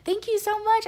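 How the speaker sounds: very high, loud thanks; gushing thanks. Retold or imitated. Passenger thanking the encouraging attendant, gushing and high. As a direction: squeal the thanks, re-enacting delight. Acting own thrilled thanks.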